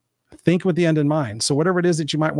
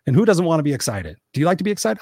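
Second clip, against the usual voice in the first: normal talking voice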